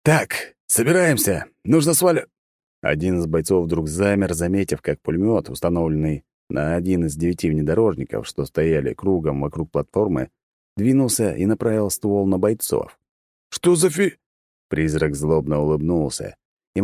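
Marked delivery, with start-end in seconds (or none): 0.0-2.3 high, urgent squad leader; hurried soldier. Acted. 13.5-14.2 high, cut-off startled cry. Acted. Soldier startled by gun.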